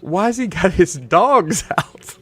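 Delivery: imitating a baffled friend drawing it out; puzzled question. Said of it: "she says".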